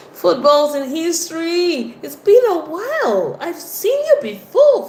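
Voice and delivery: high, loud, drawn-out welcome; thrilled to see someone back; excited greeting. Real, not acted. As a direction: voice climbing with delight at a familiar name. Greeting a returning viewer.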